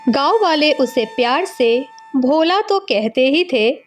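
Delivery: usual speaking voice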